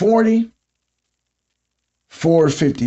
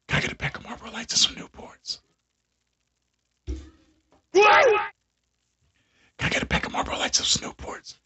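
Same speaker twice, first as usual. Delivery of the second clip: whispered, much softer request; real quiet customer order; 5 semitones higher, 8 dB quieter, faster than usual. Retold or imitated. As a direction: mimic a customer who loses their voice at the register. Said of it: "get to the register and talk like that".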